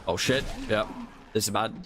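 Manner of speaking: ordinary delivery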